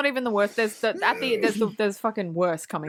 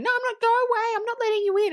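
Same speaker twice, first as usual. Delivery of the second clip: high whiny refusal; April's high, whiny refusal at the door; mock bratty refusal; 12 semitones higher, faster than usual. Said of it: "she just flat out refuses".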